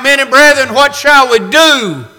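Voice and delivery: a loud high cry for help. Retold or imitated. The crowd cries out to Peter, loud and high. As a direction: call out high and loud, desperate. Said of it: "Cried out".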